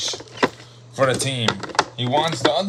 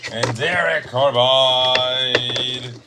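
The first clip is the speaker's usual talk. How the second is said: ordinary talk, nothing marked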